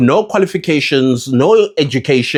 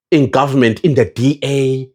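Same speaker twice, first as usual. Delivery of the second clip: ordinary talk, nothing marked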